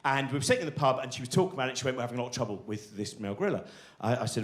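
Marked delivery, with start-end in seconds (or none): 2.0-3.6 low, soft, fast deadpan zookeeper voice; a hushed, hurried keeper's confession; imitating zookeeper. Zookeeper quoted in pub story.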